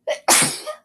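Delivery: a sudden dry cough while sick; real cough. Real, not acted. Sick vlogger coughing on camera, real cough. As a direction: cough mid-vlog, caught off guard. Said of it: "I am so sorry".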